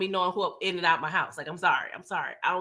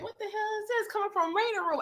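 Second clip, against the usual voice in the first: very high, softer baffled voice; mock spooked question; acted startled puzzlement; 12 semitones higher, 7 dB quieter than usual. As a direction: wonder aloud in a squeaky-high hush. Tariq startled by sound down hall.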